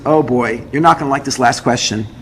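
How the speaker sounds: low retold warning; apprehensive clerk. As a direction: drop the pitch to brace them for bad news. Clerk warns of bad question.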